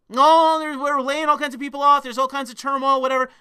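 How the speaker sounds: high, loud mock of an office excuse. Mimics HR's layoff excuse.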